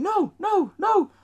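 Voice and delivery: high-pitched mock screams; imitated kiddie panic; screaming kids. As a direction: squeal like frightened children. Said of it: "Kids are screaming".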